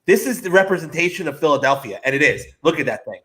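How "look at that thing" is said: normal talking voice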